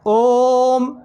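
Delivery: ओम held long on a steady tone; three-beat pluta vowel. Shown as a demonstration. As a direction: stretch ओम out for three full beats. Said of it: "इस प्रकार का उच्चारण करते हैं तो वहाँ पर तीन मात्रा".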